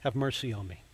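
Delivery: soft, quiet begging; a mild plea from a servant in debt; pleading. Retold or imitated. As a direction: plead for mercy under your breath. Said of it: "asks him for mercy".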